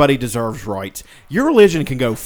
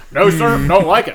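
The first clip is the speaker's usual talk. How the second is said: cartoon voice; 4 semitones higher, 6 dB louder than usual. Cartoon character impression.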